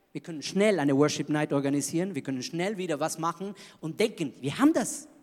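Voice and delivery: usual speaking voice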